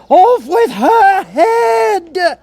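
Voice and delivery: shrieked high death sentence; shout. A narrator's reading. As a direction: scream the sentence at the top of your voice.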